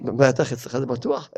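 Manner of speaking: low, soft dry mockery; sarcastic retort. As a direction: mutter it back with dry irony. (תשובה עוקצנית ושקטה)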